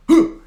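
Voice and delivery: imitating a drunk man's sudden loud grunt; mock drunk grunt. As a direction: bark out a startled huh like the drunk guy. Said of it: "he started going".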